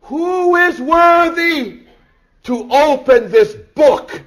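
high, loud, slow proclaiming; angel's loud cry. Acted. Preacher echoing the angel's proclamation, loud and slow. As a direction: ring it out across heaven. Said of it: "an angel cries out".

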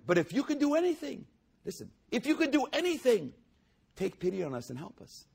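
high, fast, desperate begging; pleading father. Acted. Father begging Jesus to heal his son, high desperate plea. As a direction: beg like a father out of hope.

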